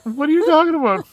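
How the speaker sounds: high, loud playful protest; mock indignation. Real, not acted. Camper teased by partner about fishing skills, loud mock indignation. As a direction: protest loudly, voice leaping up.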